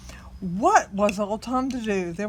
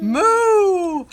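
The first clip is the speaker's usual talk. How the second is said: high, loud moo; cow moo; 7 semitones higher, 10 dB louder than usual. Shown as a demonstration. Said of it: "What does the cow say".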